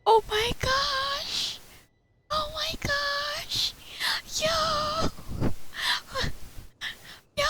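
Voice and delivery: excited squeal. Real, not acted. Streamer shocked by the immortal's line, squealing. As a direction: stretch each gasp up into a squeal. Reacting to a game reveal.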